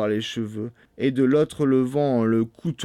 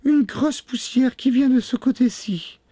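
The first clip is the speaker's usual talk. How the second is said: very high falsetto reply; sister Anne; 3 dB louder than usual. A narrator's reading. Sister on the tower answering, high and calling. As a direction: call down in a high woman's voice.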